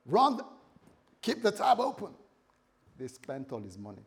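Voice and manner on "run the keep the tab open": loud, high showy club boast; big spender. Acted. Lost son treating the boys in the club, loud showy voice. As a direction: call it out to the whole room. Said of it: "it's like, boys".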